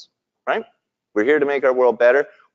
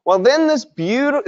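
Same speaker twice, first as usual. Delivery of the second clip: excited; 11 semitones higher than usual. Real, not acted. Excited start of story.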